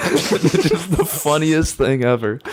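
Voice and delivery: slow, high, laughing through the words. Real, not acted. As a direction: barely get it out for laughing. Laughing at her own story.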